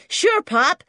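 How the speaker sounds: put-on loud, high eager kid; brash American boy. A narrator's reading. Junior answering his Pop, high and loud. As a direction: pipe up loudly as an American boy.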